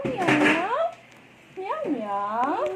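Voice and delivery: ordinary talk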